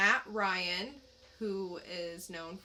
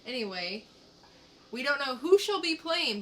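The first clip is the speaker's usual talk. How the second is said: normal talking voice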